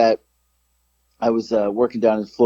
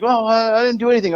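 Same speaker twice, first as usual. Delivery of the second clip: higher-pitched, stumbling mock denial; mumbling old man; 9 semitones higher, faster than usual. Drunk old man denying it to the employee, mumbling. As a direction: imitate a fuddled drunk protesting innocence. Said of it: "like, mumbling and stumbling".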